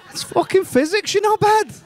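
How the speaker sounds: ordinary talk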